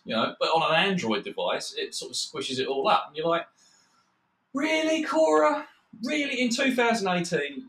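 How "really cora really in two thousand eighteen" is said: high-pitched mock incredulity; exasperated disbelief. Frustrated customer scolding Cora over broken software, high-pitched exasperated disbelief. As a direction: pitch way up, indignant at the company.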